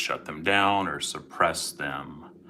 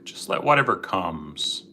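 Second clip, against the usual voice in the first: guided meditation; 7 dB quieter than usual. Acted. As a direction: gently guide listeners into calm.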